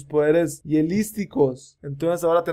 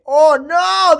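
high, loud oh no; hammed-up panic; hero dismayed; 11 semitones higher, 8 dB louder than usual. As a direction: cry out as you're swallowed. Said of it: "dice Will".

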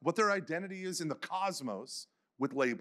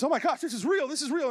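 higher, louder amazed exclamation; overwhelmed awe; 10 semitones higher, 5 dB louder than usual. Retold or imitated. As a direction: let the revelation burst out. Man overwhelmed by conversion.

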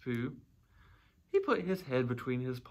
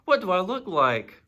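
high, loud call from above; shouted question; 6 semitones higher, 10 dB louder than usual. A narrator's reading. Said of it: "shouted Winnie the Pooh down to you".

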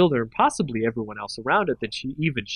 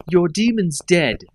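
slow, louder, higher snap; snapped; 5 semitones higher, 4 dB louder, slower than usual. A narrator's reading. Nissa snapping at Liliana, angry snap. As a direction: bite it off at her. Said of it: "Nissa snapped".

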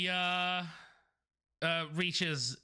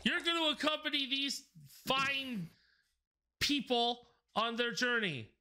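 commanding master; 7 semitones higher than usual. Acted.

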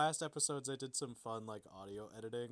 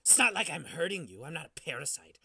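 loud, raised self-justifying; loud insistent defense; 5 semitones higher, 8 dB louder than usual. Acted. Squatter insisting to Riley it is useful, loud insistence. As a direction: insist on your worth, loudly.

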